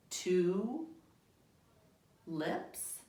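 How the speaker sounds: "Two" and "lips" with a long pause between; slow separate words. Shown as a demonstration. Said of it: "Read it faster".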